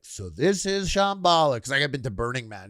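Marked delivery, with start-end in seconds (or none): 0.0-1.6 high, slow mock-grand announcement; clowning. Clowning as he walks by, high mock awe. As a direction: proclaim it in exaggerated, drawn-out wonder. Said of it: "I was kinda clowning it".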